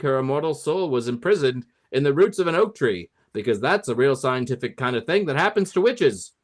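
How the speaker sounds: put-on old-movie mid-Atlantic accent. Host delivers movie monologue to listeners in a mid-Atlantic accent. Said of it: "do a mid-Atlantic accent".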